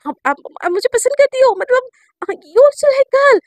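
high and loud, tripping over words; excited questioning. Retold or imitated. Girl eagerly asking her crush if she likes girls.

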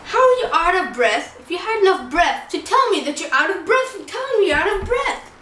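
ordinary talk, nothing marked

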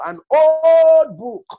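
high, loud, slow mock of "an old book", "old" stretched; scornful stretched "an old book"; mocking drawl. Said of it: "Oh, this is just an old Bible".

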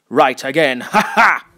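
smug taunt ending in a laugh; gloating laugh. Acted. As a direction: crow at him, then laugh.

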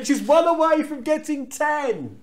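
high-pitched commentary send-up; mock commentator. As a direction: parody an announcer hyping a record. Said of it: "pointed out on commentary".

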